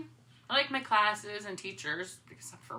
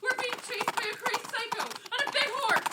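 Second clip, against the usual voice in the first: high, frantic shriek of fear; terrified high shriek; panicked cry; 13 semitones higher than usual. Acted. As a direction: blurt it out while running for your life. Fleeing the headless rider.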